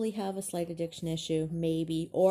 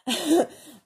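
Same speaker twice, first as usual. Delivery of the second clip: laughing; 11 semitones higher, 10 dB louder than usual. Real, not acted. Embarrassed laugh at hiccups.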